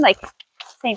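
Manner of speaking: ordinary talk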